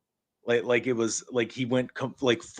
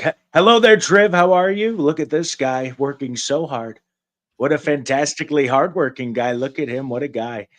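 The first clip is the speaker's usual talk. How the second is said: hamming up Trump for Triv at the job interview; Trump impression; 2 semitones higher, 4 dB louder than usual. Trump voice, loud opening that settles. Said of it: "talk like Trump".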